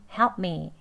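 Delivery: "Help me" joined up, with a light L; conversational pronunciation. Shown as a demonstration. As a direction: say "Help me" short, tongue tip barely tensed. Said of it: "혀끝 살짝 긴장만 하고".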